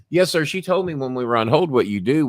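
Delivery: a touch of a cheerful call-center man; imitating polite supervisor. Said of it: "Vincent's on the phone".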